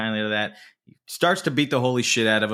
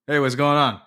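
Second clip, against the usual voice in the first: higher, louder cheery mock greeting; imitating a creepy casual hello; mock friendly greeting; 4 semitones higher, 4 dB louder than usual. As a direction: greet the severed ear like an old pal. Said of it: "and he goes".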